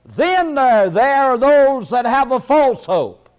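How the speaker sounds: high, emphatic pulpit voice; preaching. Real, not acted. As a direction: launch the new point with fervor. Preaches with rising force.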